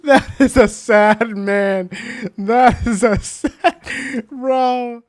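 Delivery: slow, laughing through the words; amused laughter. Real, not acted. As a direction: chuckle at your own sad face. Laughing at own sad face.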